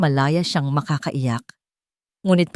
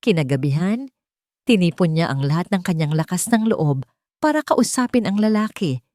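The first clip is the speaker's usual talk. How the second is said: usual speaking voice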